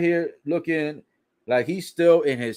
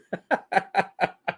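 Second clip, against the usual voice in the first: short laugh; 5 semitones higher than usual. Real, not acted. Laughing at insult.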